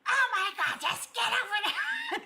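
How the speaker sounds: mock the impatient father barking at the kids; imitating her dad. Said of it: "That's the way he talked".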